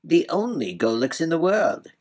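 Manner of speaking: put-on proud, high-pitched old man. A narrator's reading. The Golux boasting to the prince, proud. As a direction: declare it with great self-importance. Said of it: "said the Golux proudly".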